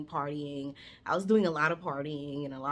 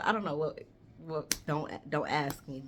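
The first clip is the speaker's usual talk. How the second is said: ordinary talk, nothing marked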